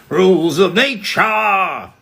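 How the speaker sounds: high slow dramatic reading; character line. Pretend. Quoting a game line for chat. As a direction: deliver it slow and grand. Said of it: "character line for chat".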